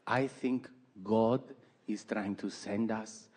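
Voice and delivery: slow, fading to a hushed mystical Peruvian mom; Peruvian accent. Retold or imitated. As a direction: trail off into God's message. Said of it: "she's from South America, Peru".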